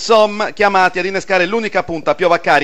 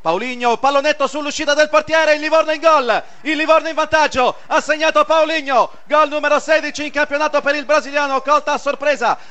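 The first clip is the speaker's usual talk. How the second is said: excited goal call, pitched up; breathless italian goal commentary; 5 semitones higher than usual. Real, not acted. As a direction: rush the goal call, thrilled. Goal called live on radio.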